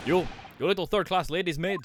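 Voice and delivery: put-on angry Russian accent. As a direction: snap at the maid in a heavy Russian accent. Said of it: "I can't try to fucking accent".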